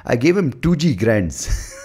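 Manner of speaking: slow, high imitation of Indian's fake American accent; mock American-tinged accent. Mocking Indians abroad, high, slow put-on accent. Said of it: "who say".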